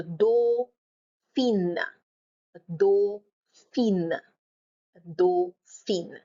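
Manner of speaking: "dauphine" slowly as doh - FEEN; syllable by syllable. Shown as a demonstration. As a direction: stretch "dauphine" into two parts, sounding the N. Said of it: "split your word into syllables".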